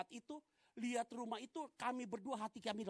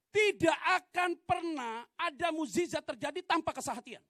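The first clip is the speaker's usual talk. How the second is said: loud, raised-pitch sermon delivery; forceful preaching; 7 semitones higher, 11 dB louder than usual. Real, not acted. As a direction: drive the promise home to the congregation, loudly. (berkhotbah dengan lantang dan berapi-api)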